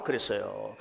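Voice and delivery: ordinary talk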